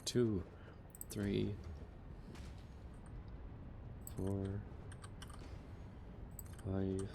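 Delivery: soft, absent-minded count under the voice; counting to self. Real, not acted. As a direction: count quietly to yourself, with gaps. Counting blocks to himself while building.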